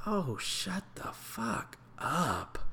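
slow, breathy mutter under the breath; annoyed whisper. Retold or imitated. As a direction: whisper it so he can't hear. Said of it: "I'm just right behind him, like".

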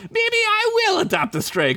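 very high sly taunt. Acted. Retake of line 59.

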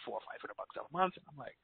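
usual speaking voice

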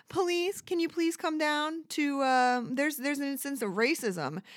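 high, timid voice calling the police; lightly voiced nervous caller; mock polite caller. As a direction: play it meek and overly polite. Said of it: "mock call to the police".

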